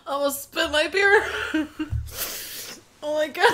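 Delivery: talking through helpless laughter; laughing. Real, not acted. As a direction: barely get it out for laughing.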